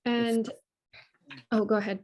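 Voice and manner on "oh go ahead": ordinary talk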